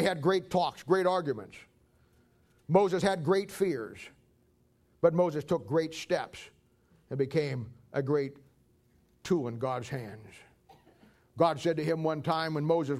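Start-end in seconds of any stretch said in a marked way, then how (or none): 2.7-10.3 slow emphasis. Acted. Preacher slows for emphasis.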